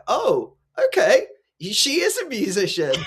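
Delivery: high, surprised acceptance breaking into a laugh; hammed-up "oh okay" realisation with a laugh; mock surprise. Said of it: "that I was like".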